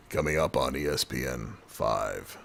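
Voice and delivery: low, mock TV sports announcer voice; a hint of a cable sports promo.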